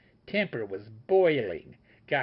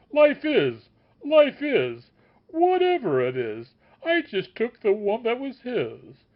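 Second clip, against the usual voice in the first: anguished lament; 7 dB louder than usual. A narrator's reading. The master wails over the dead sage, loud.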